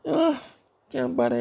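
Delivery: high-pitched exasperated sigh-and-give-up; imitated frustration. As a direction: act out giving up, pitch jumping high. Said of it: "just get frustrated".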